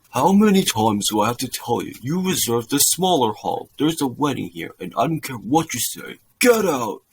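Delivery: scold, then burst out on the last words; shouting priest. A narrator's reading. Priest orders the lady out of the hall, shouting. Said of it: "shouts at the lady".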